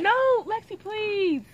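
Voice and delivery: high whiny begging; pleading. Real, not acted. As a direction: beg for it. Begging for the chicken.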